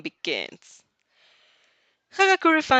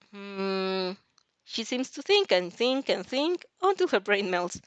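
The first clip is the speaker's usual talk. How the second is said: ordinary delivery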